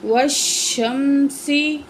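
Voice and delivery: every sound stretched very slowly; slow blended reading. Shown as a demonstration. Teacher joining syllables into a word for the pupil, very slow. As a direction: draw the joined word out carefully. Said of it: "کیا بن گیا؟".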